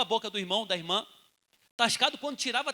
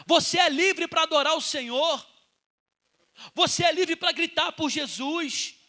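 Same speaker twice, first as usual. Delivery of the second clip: loud exhortation, pitch climbing; fervent preaching; 4 semitones higher, 5 dB louder than usual. Real, not acted. Preacher urging church to cry out to Jesus, fervent shout. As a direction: preach it at full force.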